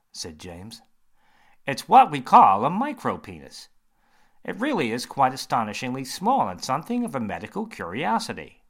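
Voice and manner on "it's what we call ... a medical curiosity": louder, brighter surgeon voice; a confident doctor holding forth. Acted. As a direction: surgeon quizzing James, bright and loud.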